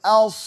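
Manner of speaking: "As" with a heavy vowel and long s; dark sustained hiss ending "As"; flowing sad. Shown as a demonstration. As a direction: let the thick s of "As" run on. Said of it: "Kemudian sad".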